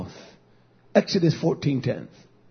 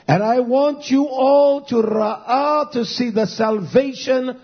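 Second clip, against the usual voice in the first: ringing, drawn-out proclamation of the verse; solemn pulpit declamation; declamatory scripture preaching; 3 semitones higher, 3 dB louder, slower than usual. Real, not acted. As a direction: proclaim the promise, leaning hard on key words.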